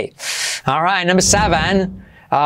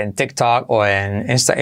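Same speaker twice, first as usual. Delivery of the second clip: normal talking voice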